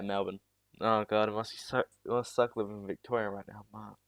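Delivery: normal talking voice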